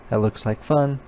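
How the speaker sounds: ordinary delivery, nothing marked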